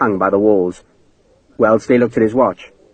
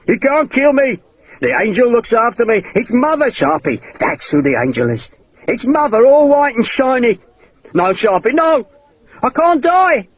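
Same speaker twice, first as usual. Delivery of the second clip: much higher, desperate crazed cries; frantic pleading; 9 semitones higher than usual. Acted. Hakeswill pushed back by the sword tip, frantic high pleading. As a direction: shriek it like a madman losing control.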